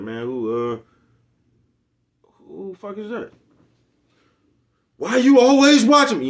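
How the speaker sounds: high, loud mocking squeal of a caught woman; mocking an embarrassed woman's high yell.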